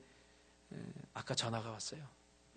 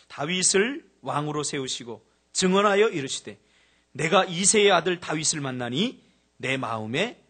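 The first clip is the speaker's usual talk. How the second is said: slow, solemn reading of the verse; scripture reading; 10 dB louder, slower than usual. Acted. Preacher reading Acts to the church, slow and solemn. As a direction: read the Bible line deliberately.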